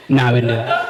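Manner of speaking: ordinary talk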